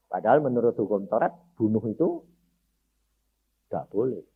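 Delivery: ordinary talk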